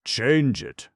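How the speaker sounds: put-on old man beside himself with rage; exasperated exclamation. A narrator's reading. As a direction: explode with exasperation.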